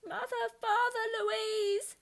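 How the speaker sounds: high desperate call; high frightened wail; crying out. A narrator's reading. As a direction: call for them in tears. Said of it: "she cried".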